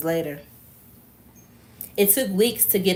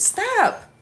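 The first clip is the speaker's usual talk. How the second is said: screamed. A narrator's reading. Kimura screaming at Sean during the attack. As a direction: cry it out in a high, contained yell. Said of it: "Kimura screamed".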